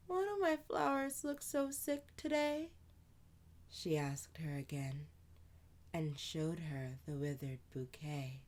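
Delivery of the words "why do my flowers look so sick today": put-on high little girl, sadly. Amani grieving her dead flowers, high childlike voice. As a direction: play a small girl mourning her flowers.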